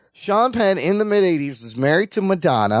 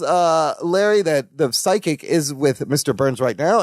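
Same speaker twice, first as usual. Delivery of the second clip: ordinary delivery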